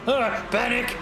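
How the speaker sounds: high hammed-up cry of panic; mock panic. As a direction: yelp it like a startled guard. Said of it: "But now they're like".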